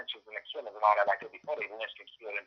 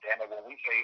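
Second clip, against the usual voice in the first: ordinary talk, nothing marked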